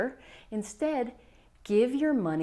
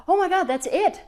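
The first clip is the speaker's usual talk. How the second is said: much higher, louder eureka cry; sudden realization; 9 semitones higher, 5 dB louder than usual. Retold or imitated. Client realizing her mistake, high excited cry. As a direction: it all clicks at once.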